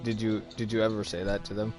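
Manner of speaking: ordinary talk, nothing marked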